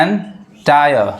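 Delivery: Entire stretched out slowly, ending in आया; entire said very slowly for the class; slow model word. Shown as a demonstration. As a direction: drag entire out syllable by syllable.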